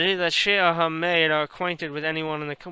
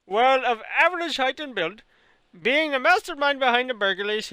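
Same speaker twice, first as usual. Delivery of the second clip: put-on Indian accent; 2 semitones higher than usual. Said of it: "talk this long with an Indian accent".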